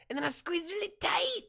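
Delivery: very high, strained squeeze; movie host impression. Host repeating his exercise, high strained comic voice. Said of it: "And he goes".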